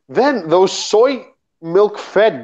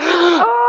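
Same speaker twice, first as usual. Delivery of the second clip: mock gasp.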